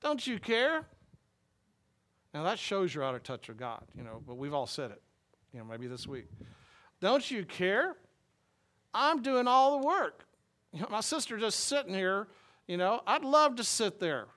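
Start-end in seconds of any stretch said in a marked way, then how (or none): 0.0-0.8 much higher, pleading, rising protest; an overworked sister protesting to her guest; exasperated complaint. Retold or imitated. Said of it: "wiping her hands and saying". 7.0-14.3 much higher and louder, a hammed-up whine; frustrated outburst. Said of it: "wiping her hands and saying".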